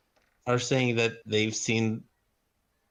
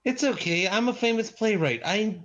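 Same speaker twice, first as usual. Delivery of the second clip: lightly acted breezy confidence, pitched up; breezy self-assurance; 6 semitones higher than usual. Playwright waving off a guard's warning, breezy and self-assured. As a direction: brush off the worry in a higher voice.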